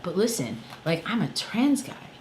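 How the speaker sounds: ordinary talk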